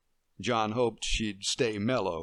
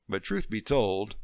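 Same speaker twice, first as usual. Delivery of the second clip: ordinary delivery, nothing marked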